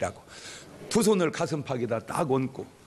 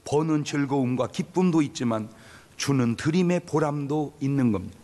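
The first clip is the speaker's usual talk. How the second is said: ordinary delivery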